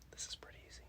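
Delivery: soft conspiratorial whisper. Real, not acted. Teacher whispering a hint to the kids.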